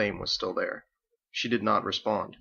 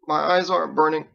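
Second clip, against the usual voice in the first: high, louder, pleading; desperate insistence; 6 semitones higher, 7 dB louder than usual. A narrator's reading. Scared boy insisting to Andelin, high and pleading. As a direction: insist it's not true, scared.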